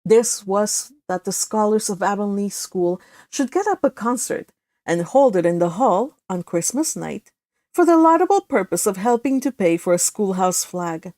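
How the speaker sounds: plain reading voice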